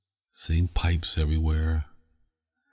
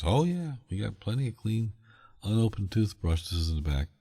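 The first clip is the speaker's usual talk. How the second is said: a lightly voiced worker, higher then softer; worker's voice; 6 semitones higher, 4 dB quieter than usual. Worker offers spare toothbrushes, lightly voiced higher. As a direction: play along as the worker, going quieter.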